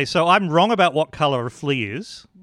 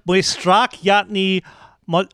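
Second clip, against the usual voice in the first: slow, higher mock-Polish syllables; fake Polish; 5 semitones higher, slower than usual. Shown as a demonstration. Showing off to gullible people, fake Polish. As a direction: pass off gibberish as Polish. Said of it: "convince gullible people I could speak Polish".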